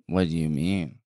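low, eerie possessed voice; a dead wife speaking through him; possessed ghost voice. Acted.

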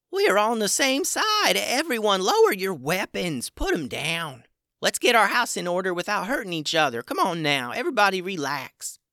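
high, urgent peacemaker voice; calm everyone down in a high voice; high little creature voice. Acted. Vernon calming the crowd.

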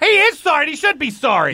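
high, heated comeback; angry retort. Acted. Pally refuses to apologize to little brother, angry. Acted quarrel between brothers.

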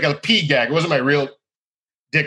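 ordinary talk, nothing marked

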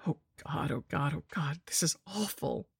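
soft trembling fearful voice; quivering, hushed with dread; tremulous voice. Acted. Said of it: "came a tremulous voice".

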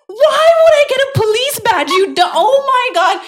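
high, loud incredulous squeal; exasperated disbelief. Retold or imitated. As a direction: shriek it in mock outrage. Retells exasperation at friend's police-badge mistake.

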